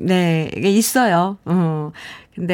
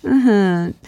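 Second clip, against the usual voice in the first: ordinary delivery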